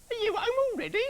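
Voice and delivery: very high, loud nagging wife; grumbling wife. Acted. Busy wife grumbles at her husband coming home, high and loud. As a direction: grumble at him in a shrill housewife voice. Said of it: "she grumbled".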